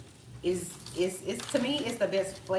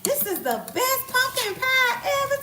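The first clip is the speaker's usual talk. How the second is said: much higher gushing voice; mocking gushing coworker; 5 dB louder than usual. Mimicking husband's coworkers raving in a high voice. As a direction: rave like a convert. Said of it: "the people being like".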